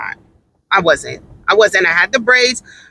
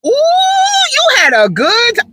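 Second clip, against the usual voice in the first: put-on sister girl. Shown as a demonstration. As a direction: gossip over the weekend like a sister girl. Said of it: "that is sister girl".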